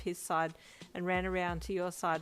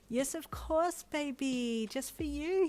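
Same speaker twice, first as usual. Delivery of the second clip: higher, beaming happy voice; happy reply; 8 semitones higher, slower than usual. Acted. Brian answering his thrilled friend, happily. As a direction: gush it out proudly. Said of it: "he replied happily".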